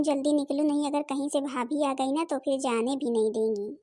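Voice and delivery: ordinary delivery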